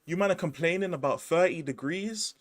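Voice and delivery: mock an American sneering at Londoners; lightly imitated American complainer. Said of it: "They'll be like".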